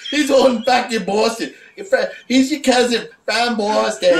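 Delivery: high, hammed-up Boston accent; comically bad Boston accent, high-pitched; mock Boston accent. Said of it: "talking in a fucking Boston accent".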